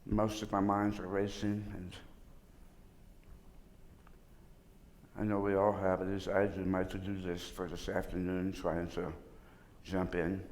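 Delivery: low, soft, unhurried prayer with long pauses; spoken prayer. Real, not acted. Teacher opens the session praying to God, soft low prayer. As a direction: pray softly and low, leaving room for silence.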